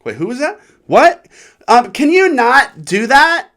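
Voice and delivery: high, loud, slow whiny voice; mocking the girl again. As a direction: stretch each word in a high nasal whine.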